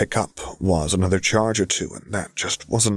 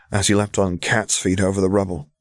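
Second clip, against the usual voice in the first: plain reading voice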